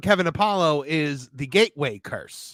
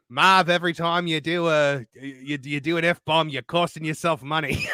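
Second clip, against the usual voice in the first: mock British accent, lower and measured; lower-pitched British accent impression; 3 semitones lower than usual. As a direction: quote him in a put-on British accent. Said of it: "only British accent you can do".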